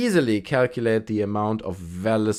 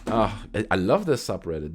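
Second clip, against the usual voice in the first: ordinary delivery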